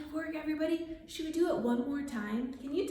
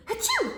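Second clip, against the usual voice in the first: high, short pretend achoo; mock sneeze; 12 semitones higher, 4 dB louder than usual. Storyteller repeating the cow's sneeze, high mock achoo. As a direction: fake a quick high sneeze. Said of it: "when the cow sneezed".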